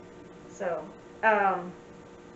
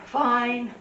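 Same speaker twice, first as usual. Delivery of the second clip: emphatic fine; 6 dB louder than usual. Shown as a demonstration. Sign teacher showing ways to say an opinion, emphatic fine. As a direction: stretch "Fine" out with force. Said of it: "So you can say".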